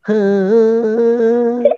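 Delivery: long, high sustained fake wail; badly faked sing-song cry; cry that sounds sung. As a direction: fake crying on one held singing note. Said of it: "Itu mah nyanyi, bukan nangis".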